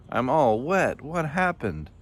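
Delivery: high, dismayed groaned complaint; groaning. A narrator's reading. Soaked Hippo asks what happened, high groaning complaint. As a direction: complain high, swooping on the question. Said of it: "groaned Hippo".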